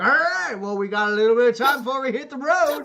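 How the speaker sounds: high, loud, fast and laughing; rowdy cheer. Acted. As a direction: boom it out like a jolly saloon regular. Tusk cheerfully calls to group.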